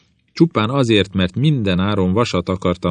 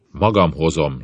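ordinary delivery

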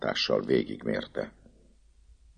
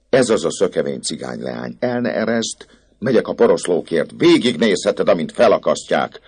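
higher, louder cruel command; gloating priest; 5 semitones higher, 6 dB louder than usual. Acted. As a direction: order her, savouring it. The priest orders the recluse. (kegyetlenül diadalmas pap)

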